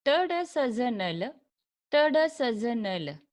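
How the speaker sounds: T, D, S, Z, N, L chanted as one quick connected string; run-together repetition drill. Shown as a demonstration. Teacher repeats the sounds as a memory trick, quick chanted drill. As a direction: link T, D, S, Z, N, L without gaps, in two rounds. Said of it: "Repeat it".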